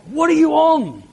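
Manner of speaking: high, mocking what are you on; incredulous. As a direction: scoff as if the idea is crazy. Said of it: "Or how much say it?".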